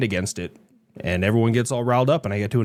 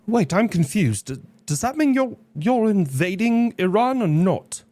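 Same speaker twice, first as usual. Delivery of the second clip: confused character voice; 12 semitones higher than usual. Acted. Mock confused character question.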